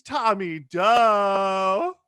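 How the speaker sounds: long drawn-out loud announce; announcer call. Acted. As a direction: stretch the name like a host. Host announcing guest to stage.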